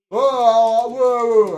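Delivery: loud calling whoa to stop. Retold or imitated. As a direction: halt everyone with loud whoas. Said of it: "He's saying".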